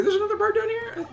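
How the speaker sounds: squeaky high character voice; high falsetto voice. Acted. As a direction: wonder aloud in falsetto.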